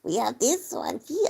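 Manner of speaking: mock Russian accent, slow; hammy Russian gnat voice, drawn out. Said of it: "Russian voice".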